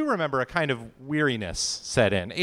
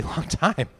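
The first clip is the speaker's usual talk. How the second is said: ordinary talk, nothing marked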